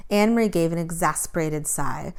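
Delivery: ordinary delivery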